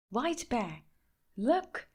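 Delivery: high, eager call to the bear; excited cry. A narrator's reading. Boy shows his friend the postcard, excited call. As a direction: call out excitedly, pitch jumping up on look. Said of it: "cried Danny".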